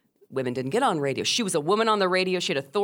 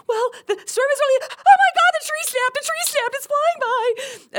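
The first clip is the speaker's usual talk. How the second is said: high mock shriek; panicked caller; 6 dB louder than usual. Caller to radio host as trees snap, terrified. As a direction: start high and jittery, burst into a loud squeal.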